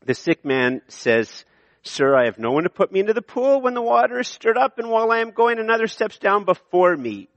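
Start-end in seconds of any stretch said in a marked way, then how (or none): 1.8-7.3 higher, fast, put-upon whine; sarcastic answer. Acted. Sick man answering Jesus with a whiny sarcastic excuse. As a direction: read it like a grumbling excuse. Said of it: "the man gives a sarcastic answer".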